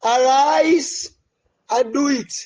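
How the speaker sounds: ordinary talk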